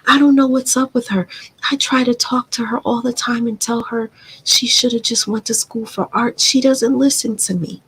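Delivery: high mock voice of a dad complaining about his daughter; imitating her father. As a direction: ham up the father griping to other people. Father voiced mocking her to others.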